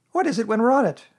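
high, loud animal character voice; brisk storybook busybody, pitched right up; Rabbit. A narrator's reading. Rabbit answering Pooh's greeting, high character voice.

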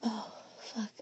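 ordinary delivery

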